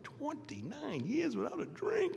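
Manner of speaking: slow, high-swooping incredulous question; acted disbelief. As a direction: stretch it out in sheer disbelief. Acts out his disbelief at sobriety.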